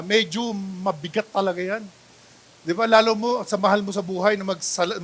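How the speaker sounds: usual speaking voice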